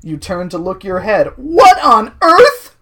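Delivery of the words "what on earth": high, loud, very slow exclamation; hammed-up shock. Driver seeing the noise's source, loud high mock shock. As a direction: stretch each word in mock astonishment.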